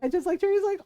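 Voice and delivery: ordinary talk, nothing marked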